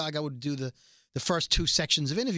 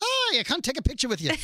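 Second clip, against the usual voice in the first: high, hammed-up cartoon Irish accent; a leprechaun-style Irish lilt, played for laughs; Lucky Charms voice; 9 semitones higher than usual. Host doing his Lucky Charms Irish voice for the cohost. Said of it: "That's my Lucky Charms voice".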